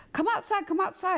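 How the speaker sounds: high-pitched, cheery urging; eager beckoning. Retold or imitated. Husband urging upset wife to see surprise, eager. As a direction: coax excitedly, voice lifted way up.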